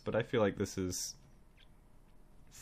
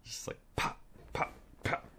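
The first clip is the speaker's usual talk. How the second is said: soft whispered pop pop pop; breathy toy-gun noises; mock gunshots; 6 dB quieter than usual. Mimicking Arnold shooting cops one by one, whispered pops.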